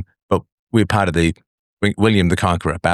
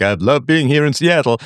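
touring performer cliché; 8 semitones higher than usual. Retold or imitated. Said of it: "I know everyone's like".

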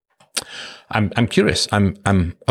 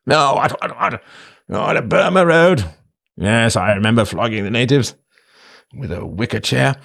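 blustering nineteenth-century British colonial, raised pitch; pompous British imperialist; 5 semitones higher than usual. Acted. Host playing an old colonial reminiscing, as pompous British imperialist. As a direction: do a pompous British empire relic. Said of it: "this is just my, uh, nineteenth century British pompous, um, imperialist".

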